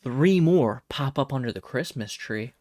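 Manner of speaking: normal talking voice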